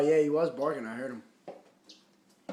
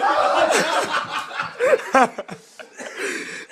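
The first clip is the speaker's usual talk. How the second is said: high shrieking breathy laugh; 6 dB louder than usual. Real, not acted. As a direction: burst into shrill laughter. Bird chaos in the room.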